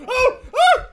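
two very high, loud yelps; startled yelps. Real, not acted. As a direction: yelp sharply in alarm. Startled during the ghost hunt.

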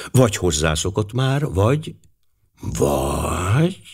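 pondering, with trailing rising repeats; musing. A narrator's reading. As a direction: think aloud, leaving it hanging. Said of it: "gondolta Poirot tűnődve". (tűnődő, függőben hagyott gondolat)

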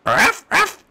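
high little dog barking; high dog yap. Acted. Dog barks.

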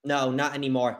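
normal talking voice

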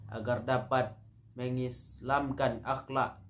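ordinary talk, nothing marked

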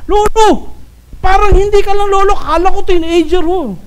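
high-pitched mock admiration; butter him up in a high voice; gushing flattery. Salesperson flattering a grandfather, gushing high. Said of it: "Ang galing nyo mang bola".

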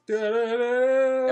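slow, loud hummed jingle; imitated jingle. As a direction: belt out the game jingle. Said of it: "he says".

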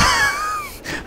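very high shriek of laughter. Real, not acted. Gamer surprised mid-fight, shrieking laugh. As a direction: shriek and break up.

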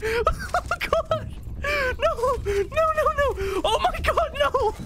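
very high panicked screaming with breathless laughs; real scared shriek. Real, not acted. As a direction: shriek in terror at a sudden attack. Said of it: "That scared the crap out of me".

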